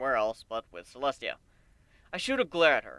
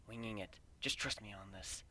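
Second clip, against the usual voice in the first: much softer, low reply; whispered reply; 5 semitones lower, 15 dB quieter than usual. A narrator's reading. Griff whispering back to Gilda, soft and low. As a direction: murmur it back reassuringly.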